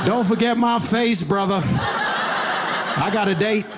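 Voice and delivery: high, slow, preening roach voice; vain roach voice. Pretend. The sprayed roach talking to himself, a high, vain, slow preen. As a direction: primp like a roach getting ready for a date. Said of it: "It was".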